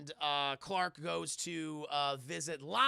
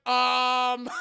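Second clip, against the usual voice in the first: loud, raised, awkward um; flustered mock um; 5 semitones higher, 13 dB louder than usual. Clark flustered by Desiree's advances, loud awkward um. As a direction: blurt a big awkward um.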